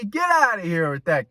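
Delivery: ordinary talk, nothing marked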